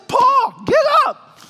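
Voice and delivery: angry shout. Retold or imitated. Preacher yelling at his praying friend Paul, angry. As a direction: snap him out of his prayer. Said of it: "I was angry with him".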